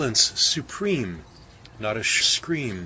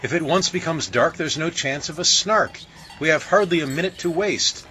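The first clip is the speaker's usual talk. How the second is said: fast and higher, hurried; hasty; 7 semitones higher than usual. A narrator's reading. Bellman urging the baker on, fast hurried speech. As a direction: rush it, no time to lose. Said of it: "cried the bellman in haste".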